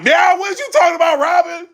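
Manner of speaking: high, loud teasing yell; shouting at Robin mid-battle; mock shout. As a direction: call her out loud and high.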